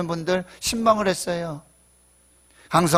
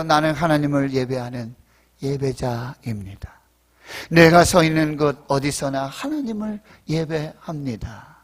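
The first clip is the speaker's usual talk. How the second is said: slow, lower devotional recitation; reverent lyric recital; 5 semitones lower than usual. Acted. Preacher reciting praise lyrics to congregation, reverent. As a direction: recite it slowly, like a prayer.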